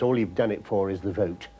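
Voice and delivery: ordinary talk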